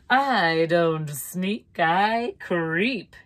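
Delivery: drawn-out, oily slow delivery; slow creepy lizard. Acted. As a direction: stretch the words like a slinking lizard.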